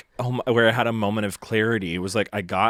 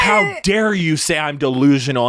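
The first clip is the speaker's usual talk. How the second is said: mock angry yell; 5 semitones higher, 7 dB louder than usual. Retold shouting match, roommate's line.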